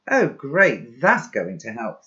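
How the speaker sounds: loud, scornful sarcasm; sarcastic. Acted. Macca mocking Pi for soaking the laptop, loud sarcasm. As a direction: sneer at the crazy idea.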